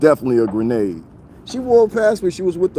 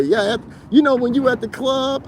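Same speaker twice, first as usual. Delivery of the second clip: higher, prissy mimicking of the woman; mock imitation of her; 10 semitones higher than usual. Mocking what the woman said about the club, pitched-up imitation. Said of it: "she had the nerve to say".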